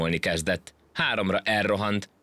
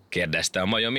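ordinary delivery, nothing marked

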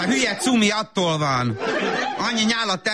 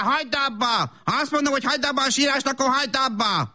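scolding; 8 semitones higher than usual. Acted. Mother scolds crying toddler.

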